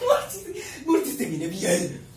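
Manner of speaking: loud laughter breaking through the words; laughing. Real, not acted. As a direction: squeal with laughter, then slow down. Bursts out laughing at video.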